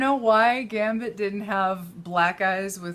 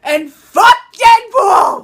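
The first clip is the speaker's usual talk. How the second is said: yelled furiously, dragged out slowly; angry shout; 9 semitones higher, 14 dB louder, much slower than usual. Real, not acted. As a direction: blurt it out in a furious yell. Venting about the movie.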